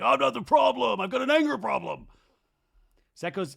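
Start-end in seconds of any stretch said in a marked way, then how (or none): 0.0-2.1 much higher, louder yelled declaration; mock shouting Janet. Janet's imaginary tattoos shouting her denial. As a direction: yell it like a slogan.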